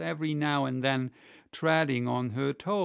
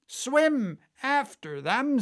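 very high, drawn-out yell; theatrical scream; 11 semitones higher, 6 dB louder, slower than usual. A narrator's reading. Gryphon urging the dancers into the sea, high scream. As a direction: scream it out in storybook excitement. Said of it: "screamed the Gryphon".

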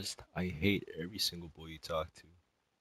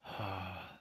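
tired sigh. Real, not acted. Sigh after long silence.

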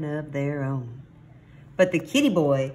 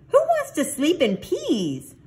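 high, loud disbelieving question; incredulous exclamation; 13 semitones higher, 5 dB louder than usual. A narrator's reading. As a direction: ask it high, as if it's absurd. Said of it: "exclaimed Scapito".

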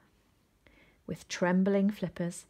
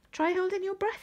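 put-on helpful high child voice; 13 semitones higher than usual. A narrator's reading. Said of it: "he suggested helpfully".

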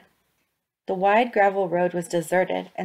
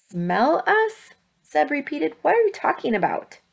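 confused; 8 semitones higher than usual. Acted. Seb confused, alarmed.

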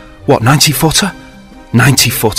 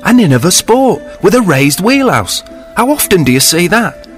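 thrilled Sinbad; 8 semitones higher than usual.

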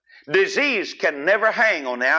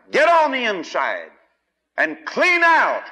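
preaching shout; 10 semitones higher, 5 dB louder than usual. Real, not acted. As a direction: call the congregation to clean out. Preacher's exhortation climax.

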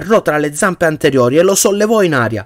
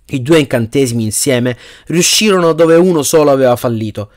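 plain reading voice

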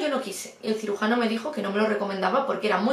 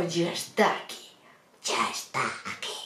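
sinister; 6 semitones lower, 5 dB quieter than usual. Retold or imitated. Surgeon as the scared patient heard him, sinister and low. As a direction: draw it out darkly, deep and soft. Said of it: "que sonó todo muy tenebroso".

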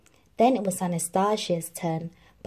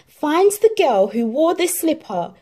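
higher, loud royal order; commanding prince; 8 semitones higher, 8 dB louder than usual. Acted. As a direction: command the duke firmly. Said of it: "he ordered".

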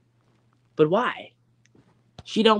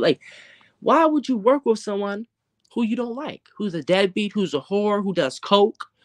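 ordinary talk

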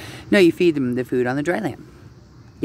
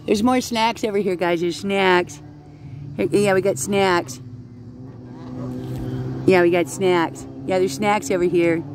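high, coaxing feeding call; calling ducks; 6 semitones higher than usual. Real, not acted. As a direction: call the flock over in a raised voice. Calling all ducks to the snacks.

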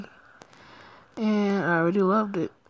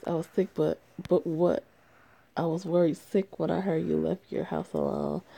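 ordinary talk

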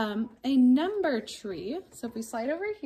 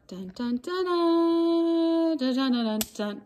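mock fanfare; 4 semitones higher, 6 dB louder, slower than usual. Fanfare for the date.

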